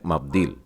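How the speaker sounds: मब्दील with the v halved, mab-DEEL, falling; teacher models मब्दील with a clipped v; मब्दील, the v just touched; half v sound. Shown as a demonstration. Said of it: "आधा व बोल करके".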